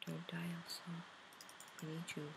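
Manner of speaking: high, softly voiced pretend plea; soft mock plea. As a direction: plead gently with the game character, half in play. Pleading with game character Elsa.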